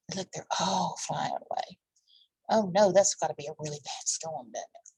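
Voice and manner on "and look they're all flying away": whisper. Real, not acted. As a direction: breathe it, barely voiced. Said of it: "reader whispers aside".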